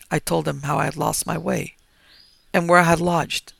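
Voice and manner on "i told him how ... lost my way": ordinary delivery, nothing marked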